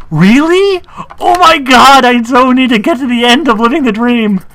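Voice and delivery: high, loud gleeful outburst; excited shout. Real, not acted. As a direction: squeal with eager delight. Thrilled by a quoted line.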